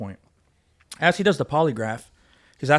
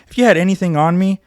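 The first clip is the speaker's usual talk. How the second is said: raised-voice retold challenge; defiant challenge; 4 semitones higher, 9 dB louder than usual. Officer challenging the FBI agent's evidence, defiant. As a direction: throw it back at them, higher and louder.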